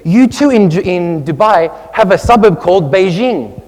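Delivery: high, loud imitation of an excited friend. Friend excited by a Dubai sign, imitated excitement. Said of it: "suddenly she gets very excited".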